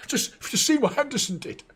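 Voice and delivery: high, giggling imitation of smug players; laughing, high-pitched mock players' banter; mock gloating players. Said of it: "were just coming out like".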